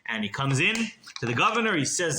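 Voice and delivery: plain reading voice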